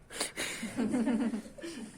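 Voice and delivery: laugh. Real, not acted. Laughing at own example.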